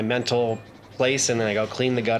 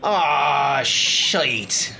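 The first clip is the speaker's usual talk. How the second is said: put-on drawn-out, louder accented curse; waking realization; 3 semitones higher, 4 dB louder than usual. Character waking from dreams with clarity, groaned curse. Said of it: "he sits up and goes".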